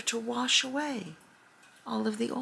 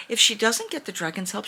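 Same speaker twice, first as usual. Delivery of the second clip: usual speaking voice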